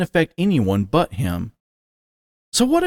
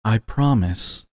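tender promise; 3 semitones lower, slower than usual. A narrator's reading. Stallion soothing his nervous partner, slow and tender. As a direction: ease his worry gently. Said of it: "giving him a gentle snort".

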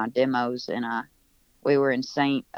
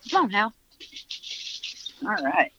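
higher-pitched call to a pet; calling the dog; 7 semitones higher than usual. Real, not acted. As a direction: call your dog over. Calls his dog to come inside.

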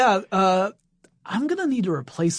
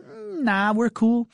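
casual dismissive; 7 semitones higher, slower than usual. Retold or imitated. Abbey voiced dismissing the idea.

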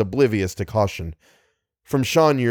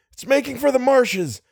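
high-pitched excited call; urgent shout; 14 semitones higher than usual. A narrator's reading. Driver tracks the fleeing monster, shouting. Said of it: "shouted Little".

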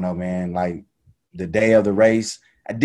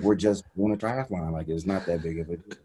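muttered to himself, much softer; dismissive thought under his breath; low and soft, shrugging it off; quiet inner thought; 5 semitones lower, 8 dB quieter than usual. Retold or imitated. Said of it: "I'm thinking to myself".

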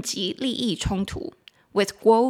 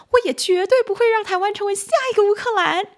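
high, loud mainland Chinese accent; 11 semitones higher, 5 dB louder than usual. Shown as a demonstration. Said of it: "一直很想用中国的发音呐".

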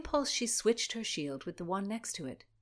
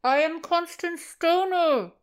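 shouted slowly from inside a helmet; high, loud and slow, straining to be heard; loud muffled; 10 semitones higher, 11 dB louder, slower than usual. Acted. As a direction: force the words out through brass. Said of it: "Speaking was difficult".

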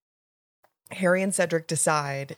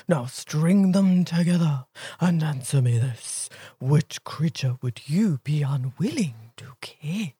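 Sphinx voice; 4 dB louder, slower than usual. Pretend. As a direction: recite it like a grand mythical beast. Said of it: "your best Sphinx voice saying this riddle".

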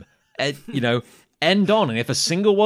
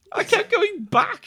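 broken; slower than usual. Real, not acted. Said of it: "I was so broken".

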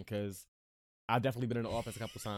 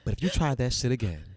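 loud threat breaking into laughter; laughing, hammed-up menace; mock workplace threat; 8 dB louder than usual. Shown as a demonstration. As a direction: turn the apology into a loud, playful warning. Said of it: "the workplace".

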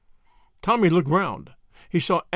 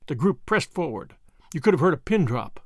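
ordinary delivery, nothing marked

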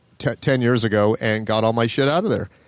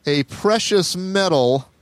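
dramatic reveal; 5 semitones higher, slower than usual. Real, not acted. Building up an embarrassing reveal.

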